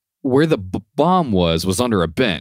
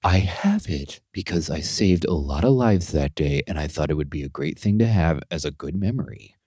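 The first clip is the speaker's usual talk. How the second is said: earnest client quote; 5 semitones lower, 5 dB quieter than usual. Retold or imitated. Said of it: "He goes".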